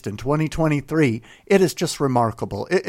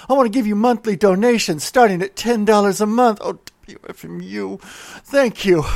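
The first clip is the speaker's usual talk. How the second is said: higher and louder, gushing like an overcome fan; gushing mock devotion; 4 semitones higher, 3 dB louder than usual. Radio host begging listeners to pledge, gushing mock devotion. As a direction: swoon over the station like a lover.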